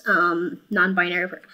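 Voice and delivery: usual speaking voice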